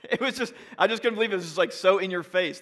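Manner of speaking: incredulous. Real, not acted. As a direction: blurt out the disbelief. Disbelief at the sign.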